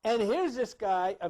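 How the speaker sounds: usual speaking voice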